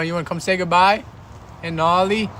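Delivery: ordinary talk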